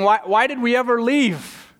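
high, slow grumbling lament; whiny Israelites. Retold or imitated. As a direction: moan like a grumbling crowd.

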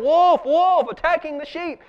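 high, loud mock cry of alarm; crying wolf. Shepherd boy fakes a wolf alarm to villagers, high loud mock cry. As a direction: call it out like a bored boy faking danger. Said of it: "So he cries out again".

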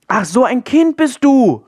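high, loud cry of sudden understanding; big high-pitched "oh, I see" exclamation; exclaiming. A narrator's reading. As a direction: call it out, drawn out and delighted to get it. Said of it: "rief sie aus".

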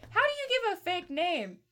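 high-pitched incredulous question; mock bafflement. Friends puzzling over fake names in a sitcom, mock bafflement. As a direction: imitate a baffled sitcom character.

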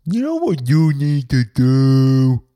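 loud, slow, put-on lecturing voice; mock a preachy busybody; bossy know-it-all. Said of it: "just be like".

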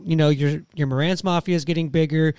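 ordinary talk

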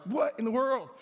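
retold exasperation at a pest; mild agitation. Man bothered by flies while praying, exasperated. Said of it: "I'm getting agitated".

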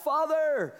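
high, drawn-out call to God; crying out. Acted. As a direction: call out for a father from afar. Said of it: "we cry out".

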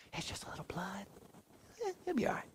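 hushed, high, whispery brush-off; mock dismissive onlooker. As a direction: play it down in a small soft voice. Imagined onlooker playing down the injury.

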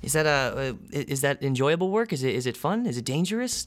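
the bubbly desk clerk greeting new arrivals; receptionist woman. Acted. GM as receptionist welcoming the test subjects, bright and chirpy.

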